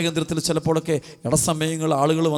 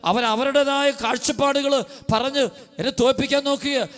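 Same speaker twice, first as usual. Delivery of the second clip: high, impassioned sermon voice; fervent preaching; 9 semitones higher than usual. Acted. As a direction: preach it out with rising fervour. Preacher voices complaining believers. (ഉയർന്ന സ്വരത്തിൽ ആവേശത്തോടെ പ്രസംഗം)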